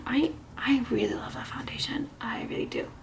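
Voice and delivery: hushed, breathy whisper of real delight. Real, not acted. Vlogger whispering her love for the foundation to viewers. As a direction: whisper it like a secret confession. Gushing about favorite foundation.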